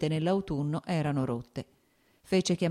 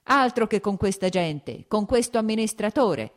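louder, higher, indignant retort; getting heated; 5 semitones higher, 6 dB louder than usual. A narrator's reading. Lievin to his estate manager, getting heated. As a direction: fire it back, raised and exasperated. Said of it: "disse Lievin riscaldandosi".

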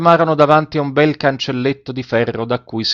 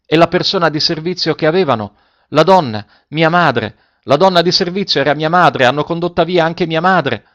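put-on desperate boy; 6 semitones higher, 4 dB louder than usual. Boy desperate over lost mother.

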